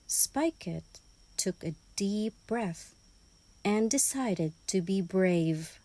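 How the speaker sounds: usual speaking voice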